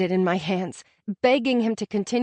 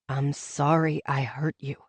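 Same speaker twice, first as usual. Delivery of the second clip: lower, voiced as Kane, murmured apology; a low, sincere murmur of apology; earnest whisper; 6 semitones lower than usual. A narrator's reading. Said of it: "he whispered earnestly".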